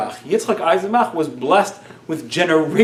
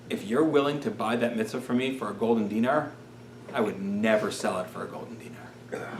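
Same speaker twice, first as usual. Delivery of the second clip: put-on softer, low, hushed boy's voice; quiet awed reply; 6 semitones lower, 10 dB quieter than usual. Boy tells the rabbi he won't sell, softly. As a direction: answer with quiet conviction.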